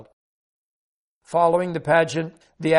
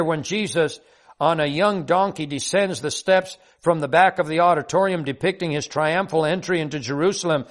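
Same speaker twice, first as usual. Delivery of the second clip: ordinary delivery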